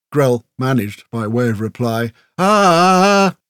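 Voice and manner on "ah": high, loud cry of pain; long pained wail; pained whimper. A narrator's reading. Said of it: "Grell whimpered again".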